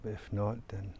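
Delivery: calm, soft meditation voice with long pauses; guided meditation. Real, not acted. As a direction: guide listeners gently into stillness, leaving long silences. Leads a guided nature meditation.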